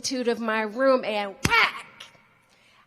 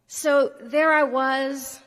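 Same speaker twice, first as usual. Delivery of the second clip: dramatic storyteller; 3 semitones higher, 6 dB louder, slower than usual. Acted. Dramatic scene opener.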